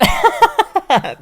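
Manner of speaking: big high burst of laughter; loud laugh. Real, not acted. Reader bursting into loud laughter.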